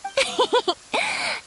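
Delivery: a light rising giggle; playful laugh. Acted. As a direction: laugh to lift his spirits. Cheering up the downcast officer.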